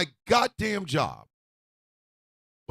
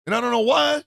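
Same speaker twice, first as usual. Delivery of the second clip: shouted rant; 4 semitones higher, 3 dB louder than usual. Real, not acted.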